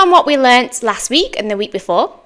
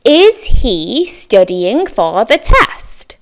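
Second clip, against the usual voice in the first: very slow, clear example question; slow model question; much slower than usual. Shown as a demonstration. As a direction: spell out "Is he studying for the test?" word by word. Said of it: "I'm going to use the word is".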